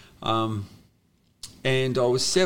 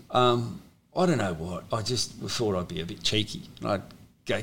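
ordinary delivery

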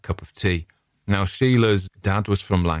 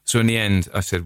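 ordinary delivery

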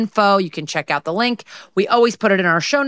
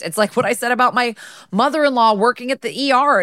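ordinary delivery

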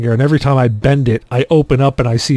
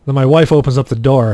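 ordinary talk, nothing marked

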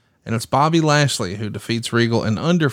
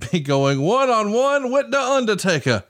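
announcer voice; 9 semitones higher than usual. Pretend. Dramatic announcer build to Undertaker.

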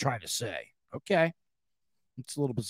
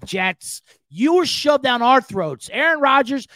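ordinary talk, nothing marked

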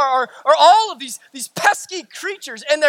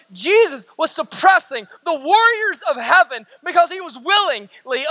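raised, emphatic sermon voice; full-force preaching; 2 semitones higher than usual. Real, not acted. Preacher insisting Jesus went willingly, forceful proclamation. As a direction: drive each phrase home to the congregation.

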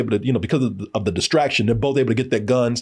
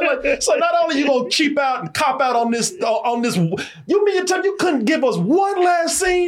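high, shouting rant at the writers; indignant rant; 10 semitones higher than usual. Real, not acted. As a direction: yell your complaint high and outraged, half laughing. Ranting at the show's ending.

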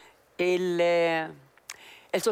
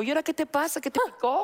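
higher, wary mock boyfriend voice; suspicious boyfriend; 7 semitones higher, faster than usual. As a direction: act out the puzzled man. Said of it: "Y me dijo". (novio desconfiado, voz algo más aguda)

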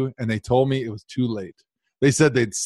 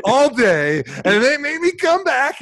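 much higher and louder, a hammed-up triumphant cry; comic outrage turned into delight; mock excited outburst; 13 semitones higher, 5 dB louder than usual. Real, not acted. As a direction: belt it out like the best news ever. Breakfast all day comeback story.

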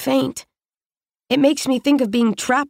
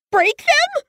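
high, cracking disbelieving cry. A narrator's reading. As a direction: let the voice break on the question. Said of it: "Her voice cracks".

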